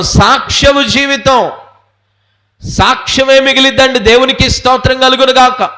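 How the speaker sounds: high, ringing sermon climax; forceful preacher's proclamation; impassioned preaching. Real, not acted. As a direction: call out for the congregation's answer. Preaching climax, calls for response.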